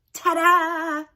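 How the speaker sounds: high, loud showy flourish; triumphant ta-da. A narrator's reading. Narrator reveals the found book with a loud flourish. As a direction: announce it like a magic trick.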